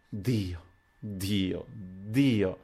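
soft, drawn-out groans of grief; heartbroken moan. A narrator's reading. Adriana with face in hands, soft heartbroken moan. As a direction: moan each word in subdued anguish. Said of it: "gemendo, straziata".

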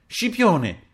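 loud, high call to someone. A narrator's reading. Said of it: "chiamò Terenzio".

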